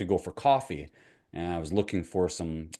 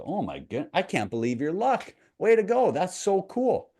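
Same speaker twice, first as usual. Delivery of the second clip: excited neighbor; 12 semitones higher, 4 dB louder than usual. Acted. Neighbor cheering the farmer's luck, rising excited gush. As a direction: voice climbs high and loud with glee.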